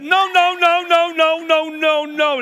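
high, loud repeated no, no; calling out. Real, not acted. Preacher calls out a kid who stood up late, loud and high.

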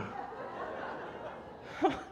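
breathy giggle; helpless high laugh. Real, not acted. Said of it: "now I'm laughing".

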